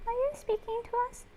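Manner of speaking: high, sing-song pet voice. Real, not acted. Cooing up high at the chicken as it clucks on camera.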